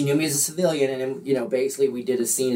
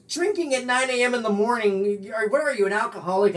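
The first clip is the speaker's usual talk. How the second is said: high, incredulous woman; mock-scolding woman, higher; scolding co-star; 6 semitones higher than usual. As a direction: needle him about the drinking, pitched up. Co-star scolding the drunk pirate.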